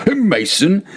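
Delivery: very high incredulous squeak; stunned, pitched right up; drunken disbelief. A narrator's reading. Fortunato doubting Montresor is a Mason, high squeak.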